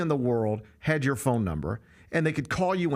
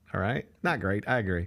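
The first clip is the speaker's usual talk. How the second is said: usual speaking voice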